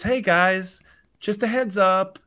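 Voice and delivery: high-pitched mock announcement; put-on high voice for an awkward heads-up; Pash. Lawyer warns the owners about the clause, high put-on voice. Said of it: "Pash steps back up again and says".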